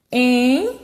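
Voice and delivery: the "em" held and sounded through the nose; nasal syllable. Shown as a demonstration. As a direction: stretch out the nasal "em". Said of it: "olha como é nasal". ("em" prolongado e nasal)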